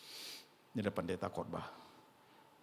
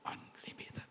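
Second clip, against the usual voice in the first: whisper; 10 dB quieter, slower than usual. Acted. Whispered emphasis.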